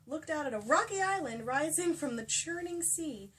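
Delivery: ordinary talk, nothing marked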